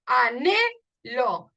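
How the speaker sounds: "Anhelo" drawn out very slowly; slow rhyme demo. Shown as a demonstration. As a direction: stretch "Anhelo" to show the elo ending. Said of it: "Elo y elo". ("Anhelo" muy alargado)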